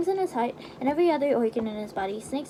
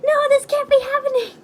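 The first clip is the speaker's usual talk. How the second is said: high, loud hammed-up despair; anguished shout; 13 semitones higher, 10 dB louder than usual. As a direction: wail it out in mock horror.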